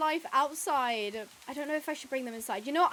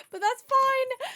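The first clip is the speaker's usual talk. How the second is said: high, louder sing-song brush-off; breezy dismissal; 11 semitones higher, 6 dB louder, slower than usual. Real, not acted. Shrugging off the bad Spanish, a high breezy brush-off. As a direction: wave it away cheerfully.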